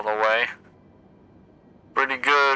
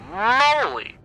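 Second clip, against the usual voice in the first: startled yelp; 13 semitones higher, 4 dB louder than usual. Real, not acted. Storm hits the plane.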